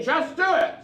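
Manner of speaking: high, bright chant for the crowd to copy; cheer prompt. Shown as a demonstration. Performer leading the crowd in a chant, excited. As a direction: lead the crowd in a call. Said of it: "Everybody say".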